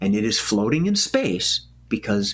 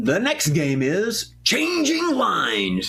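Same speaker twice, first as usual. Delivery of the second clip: announcer voice; 6 semitones higher, slower than usual. Real, not acted. Host announcing next game.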